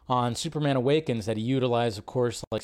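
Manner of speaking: ordinary talk, nothing marked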